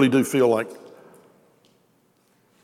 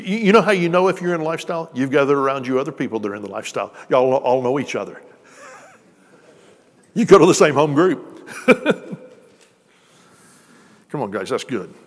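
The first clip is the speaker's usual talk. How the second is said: normal talking voice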